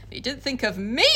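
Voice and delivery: normal talking voice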